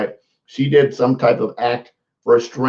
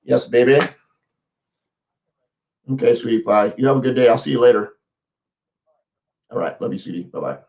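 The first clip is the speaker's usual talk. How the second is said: tender to wife. Real, not acted. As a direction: fade to a real tender murmur. My wife is calling me.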